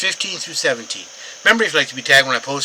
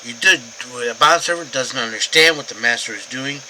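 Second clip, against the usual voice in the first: ordinary delivery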